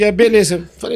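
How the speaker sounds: usual speaking voice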